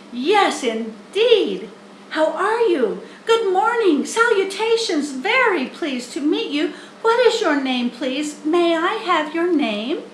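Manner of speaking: put-on high, loud eager young pig; young pig Wilbur. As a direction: greet her like a thrilled piglet. Wilbur greets the spider.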